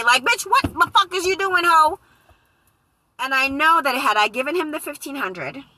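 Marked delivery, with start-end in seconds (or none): 0.2-2.0 high, loud scolding; chewing herself out at full volume; furious inner voice. Retold or imitated. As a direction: spirit yelling at her, high and loud. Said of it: "my spirit was over here ... choking".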